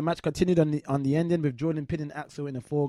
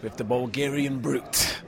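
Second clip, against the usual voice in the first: movie voice; 5 semitones lower than usual. Acted. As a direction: put on a deep, slightly threatening announcer voice. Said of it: "you sound a little hostile".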